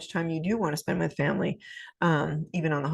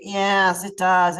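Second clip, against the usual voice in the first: meek, resigned agreement, said louder; a yes-man caving in; passive giving in; 6 dB louder, much slower than usual. Shown as a demonstration. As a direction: give in to her without a fight. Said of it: "a passive approach would be".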